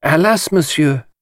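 put-on light French-accented detective; polite French lilt. A narrator's reading. Poirot answers Chaitana in a light French accent. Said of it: "said Poirot".

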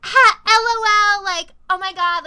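high, loud, drawn-out mocking squeal; mock hilarity. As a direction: imitate an annoying friend cracking up. [name] finding the echo hilarious.

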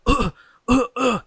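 high, loud mock coughing fits; imitating kids coughing from pepper spray; imitated coughing. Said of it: "they're coughing".

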